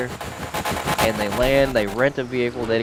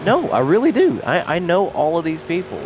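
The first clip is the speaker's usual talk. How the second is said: high-pitched, eager protest; pleading to be believed; eager insisting; 6 semitones higher, 3 dB louder than usual. Retold or imitated. Dave insists to his boss he knows celebrities, high and eager.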